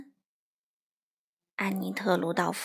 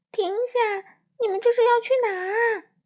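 much higher desperate cry; dream shout; 11 semitones higher, 4 dB louder than usual. A narrator's reading. Crow in a nightmare calling gifts, desperate cry. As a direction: call after the flying gifts. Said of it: "花袜子在梦里大喊".

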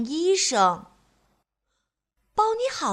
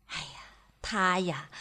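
put-on soft confiding whisper to the child; hushed storyteller aside; 6 semitones lower, 7 dB quieter than usual. A narrator's reading. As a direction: lean in and whisper it.

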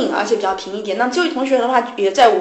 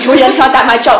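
"叫喊" pushed out louder, like a canteen auntie shouting; mock hawker call; 3 semitones higher, 9 dB louder, faster than usual. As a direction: lightly imitate an auntie hawking. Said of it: "大妈叫喊". (略带模仿食堂大妈叫卖)

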